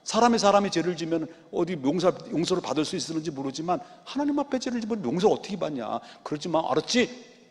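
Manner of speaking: soft, hushed, gentle coaxing; an old father softly begging his wicked sons; soft pleading father. Retold or imitated. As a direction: plead tenderly like a weak grandfather. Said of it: "이랬다는 거예요".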